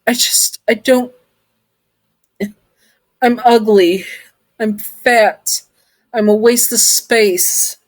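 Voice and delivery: broken, shaky voice near tears; tearful. Acted. Listener sobbing to Sun about hating herself, tearful. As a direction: choke the words out through tears. Distraught confession.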